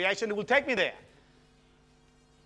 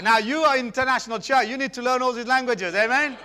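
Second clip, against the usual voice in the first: rousing preacher; 5 semitones higher, 5 dB louder than usual. Real, not acted.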